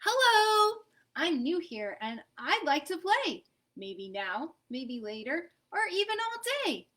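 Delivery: put-on bright, hopeful little kid greeting; a lightly voiced eager child. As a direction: sing it out like a shy new kid. Peanut Butter greets Hamburger in his voice.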